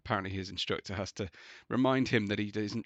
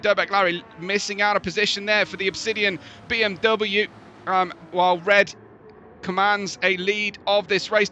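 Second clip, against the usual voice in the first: rising excitement, faster and higher as the race starts; motorsport commentator; 10 semitones higher, 9 dB louder than usual. Acted. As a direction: call the race start live, building up. Live race play-by-play.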